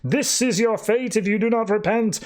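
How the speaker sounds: high-pitched hammed-up warning to sinners; mock doomsday preacher. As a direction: imitate a preacher proclaiming damnation. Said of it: "he would basically just pull people in and tell them".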